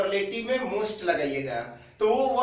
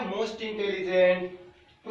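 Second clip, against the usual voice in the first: slow dictation; 3 semitones lower, much slower than usual. Shown as a demonstration. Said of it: "क्या हो जाएगा?".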